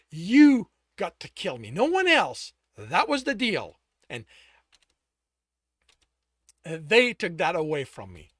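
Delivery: loud bitter rage at the lover; furious accusation. Acted. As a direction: spit out the betrayal.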